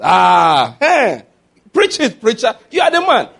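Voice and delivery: high, loud cheering; mock cheering husbands. Pastor imitates husbands cheering his chapter on wives, loud and excited. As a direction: shout it like men egging on a preacher. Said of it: "husbands react to the chapter".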